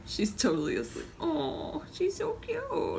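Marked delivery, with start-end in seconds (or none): 1.2-3.0 high, soft, drawn-out cooing; adoring coo. Real, not acted. Host noticing she's totally asleep, cooing tenderly. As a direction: melt softly over a sleeping little one.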